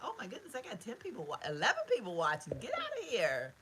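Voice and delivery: gasping at viewer count, pitch way up; delighted surprise. Real, not acted. To live viewers, amazed at the count, high excited surprise.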